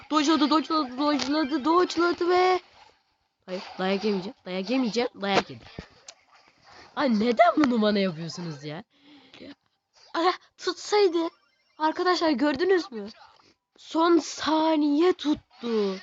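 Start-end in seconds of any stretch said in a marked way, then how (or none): 0.1-2.6 chanting the word higher each time; excited higher chant; rising excitement. Real, not acted. 10.1-16.0 high, slow amazement; drawn-out higher astonishment; amazed. Real, not acted. Amazed at last-second catch.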